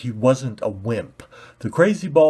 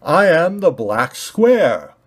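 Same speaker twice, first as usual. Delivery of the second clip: slow, loud proclamation; grand announcement; 6 semitones higher, 7 dB louder, slower than usual. Acted. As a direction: announce yourself proudly.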